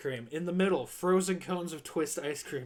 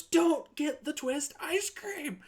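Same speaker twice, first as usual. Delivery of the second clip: a much higher, slow, stretched-out cry of alarm; panicked yell; 10 semitones higher, slower than usual. Retold or imitated. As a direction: yell it to stop him in time. Manager yells at new guy.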